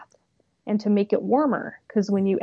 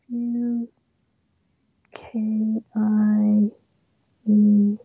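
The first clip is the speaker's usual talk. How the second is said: spelling out slowly; 4 dB louder, much slower than usual. Real, not acted. Spelling aloud while looking it up.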